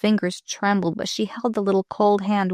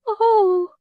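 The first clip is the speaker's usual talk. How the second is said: very high, louder cry of relief; relieved cry; 13 semitones higher, 3 dB louder than usual. Acted. Dying girl's relief as she sinks to rest. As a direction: let out a crying sigh.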